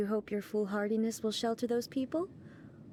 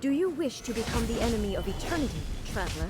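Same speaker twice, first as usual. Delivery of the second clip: forceful battle challenge; faster than usual. Acted. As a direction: push it out quick and commanding as the fight begins.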